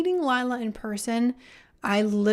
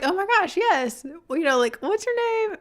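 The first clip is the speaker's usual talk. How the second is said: flustered recognition; 9 semitones higher, 5 dB louder than usual. Retold or imitated. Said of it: "and I'm like".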